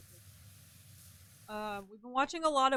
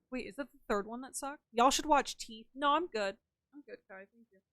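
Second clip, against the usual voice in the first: ordinary talk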